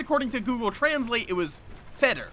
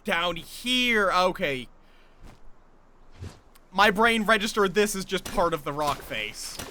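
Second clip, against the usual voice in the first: ordinary talk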